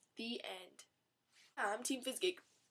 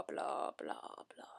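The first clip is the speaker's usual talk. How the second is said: dismissive whispered blah blah; 8 semitones lower, 6 dB quieter, much slower than usual. Pretend. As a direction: trail off into a whisper. Dismissing the plot.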